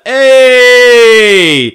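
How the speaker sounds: sudden high shouted Hey; signature Hey shout. Shown as a demonstration. Host shouting the show's sign-off Hey loudly. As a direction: belt out a big cheery Hey. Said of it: "we always sign it off with a".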